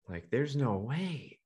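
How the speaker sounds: slow disbelieving thought. Retold or imitated. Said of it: "And I'm thinking".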